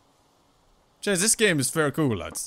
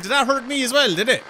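ordinary talk

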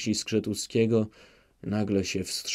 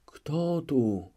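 half-awake repeat; 5 semitones higher than usual. Acted. Chmielnicki half-awake repeats, fading fear. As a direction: repeat it as the fright subsides. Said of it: "powtórzył na wpół rozbudzony".

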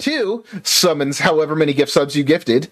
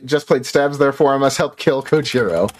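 ordinary talk

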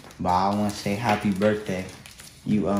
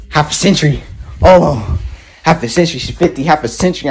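loud; 7 semitones higher, 8 dB louder, faster than usual. Real, not acted. Chanted teasing shout.

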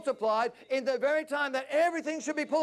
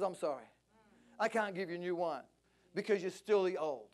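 lower, softer voice of God gently refusing; a hint of God, letting the asker down softly; God's reply; 6 semitones lower, 5 dB quieter than usual. God tells the church they are still old, lower and softer. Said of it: "God says".

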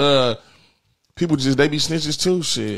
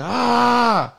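mock yell; 10 semitones higher than usual. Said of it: "I'm yelling".

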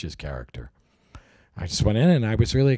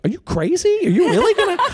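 giddy disbelief; 8 dB louder than usual. Retold or imitated. Retold thoughts meeting director, laughing.

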